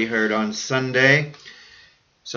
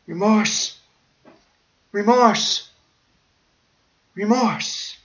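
high, tolling anguished "Remorse"; 7 semitones higher, slower than usual. Real, not acted. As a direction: let each "Remorse" ache. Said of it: "I feel it now".

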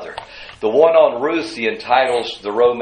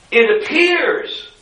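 APPEARS pushed high and drawn out; emphatic stress; 11 semitones higher, slower than usual. Real, not acted. Preacher qualifying a claim about the Lord's hand, emphatic stress on "appears". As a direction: lean hard on "appears". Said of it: "let me emphasize".

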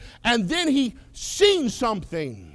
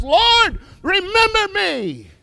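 crying out; 9 semitones higher, 5 dB louder, slower than usual. Acted. Thief cries out to Christ.

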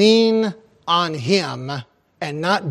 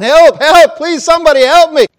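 loud, high desperate cry for help; panicked shout for help; calling for help; 11 semitones higher, 13 dB louder than usual. Acted. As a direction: yell it from the cliff, loud and high. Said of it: "and he's calling".

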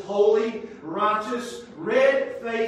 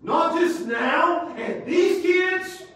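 emphatic slow exclamation; 8 semitones higher, 5 dB louder, much slower than usual. Real, not acted. Preacher stresses point.

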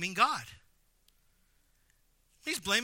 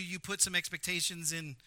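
ordinary talk

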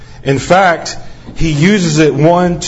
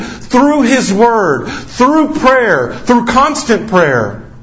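preaching; 6 semitones higher, slower than usual. Acted. Preaching climax.

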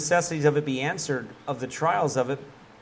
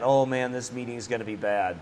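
low, much softer doomed groan; mock dread; 5 semitones lower, 5 dB quieter than usual. Anyone dreading a meeting, low soft mock dread. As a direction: sigh it like certain disaster. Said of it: "we all do that".

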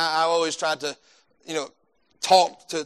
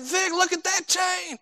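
much higher, slow x0.6 wonder; mock amazement; 13 semitones higher, slower than usual. Preacher admiring Vic's gold chain, high and slow. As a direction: marvel at the chain slowly.

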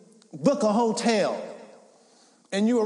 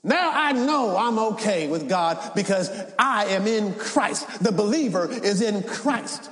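ringing Easter preaching cry; preaching at full force; 7 semitones higher than usual. Acted. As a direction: whoop it up like a preacher at the peak. Preaching climax, shouting.

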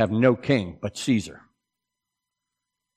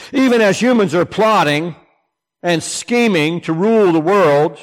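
louder, higher preaching at full force; rally the congregation, lifting the voice; preaching build; 7 semitones higher, 4 dB louder than usual. Real, not acted.

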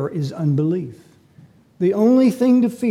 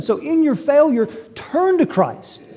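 pleading exhortation; 11 semitones higher, 4 dB louder, slower than usual. Acted. Urgent exhortation at sermon's end.

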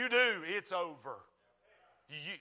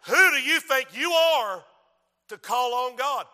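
loud, raised challenge, lightly voiced devil; taunting devil; 5 semitones higher, 11 dB louder than usual. Devil scolding the praying preacher, loud taunt. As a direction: challenge them as the accusing devil.

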